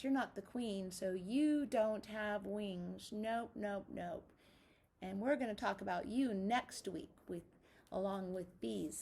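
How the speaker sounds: usual speaking voice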